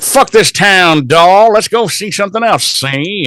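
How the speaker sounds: high, hammed-up old-movie gangster; exaggerated wiseguy imitation; mock tough guy. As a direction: play a cartoon gangster making his getaway. Acting Spike's tough getaway line.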